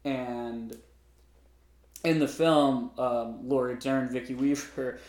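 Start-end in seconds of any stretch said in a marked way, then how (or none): none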